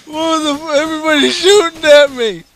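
high, loud panicked shout; shrieking under fire; raging gamer. Real, not acted.

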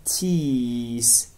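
TEETH with a slow, stretched EE; drawn-out vowel. Shown as a demonstration. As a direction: hold the EE in TEETH, as a demonstration. (تلفظ کشیده TEETH با «ای» بلند)

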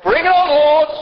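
loud, high rallying cry; acted zealous congregant's shout; eager believer. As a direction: shout it up to God with gusto. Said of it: "Some of you are like".

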